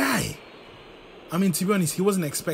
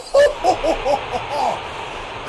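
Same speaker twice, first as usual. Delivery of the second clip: loud incredulous laugh; cracking up in disbelief; high burst of laughter; 12 semitones higher, 7 dB louder than usual. Real, not acted. Laughing at conceded goal.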